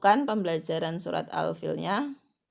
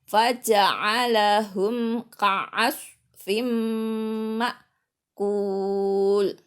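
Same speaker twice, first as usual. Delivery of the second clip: slow, loud Quran chant; sustained model chant, last syllable drawn out; full recitation from the start; 4 semitones higher, 7 dB louder, much slower than usual. Shown as a demonstration. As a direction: recite the verse deliberately with long nasal holds. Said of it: "Harus dari depan".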